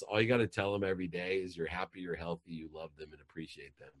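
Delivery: normal talking voice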